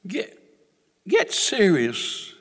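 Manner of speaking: ordinary talk, nothing marked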